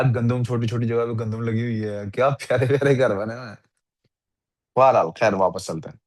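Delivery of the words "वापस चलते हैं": ordinary talk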